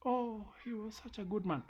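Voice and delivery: high, sugary fake mourning; mock grieving widow. Mocking the wife who praises her dead husband. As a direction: mimic a widow's hollow praise. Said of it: "Lakini huyo jamaa akidedi saa hii".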